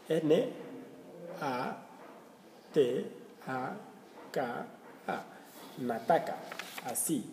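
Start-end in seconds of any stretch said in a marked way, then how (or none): none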